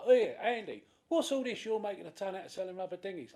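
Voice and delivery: hammed-up nosy shopper; mock shop gossip. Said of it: "be like".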